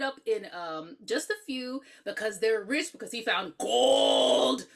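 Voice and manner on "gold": long drawn-out "gold"; leprechaun's word. Retold or imitated. Host mimicking how he says gold, drawn-out mimicry. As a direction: stretch it greedily like the leprechaun. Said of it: "That's how he says".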